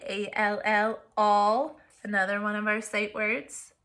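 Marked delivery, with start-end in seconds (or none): 0.0-1.0 A L L spelled letter by letter; spelling out letters. Shown as a demonstration. Teacher spelling the sight word for students, letter by letter. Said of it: "our word all highlighted".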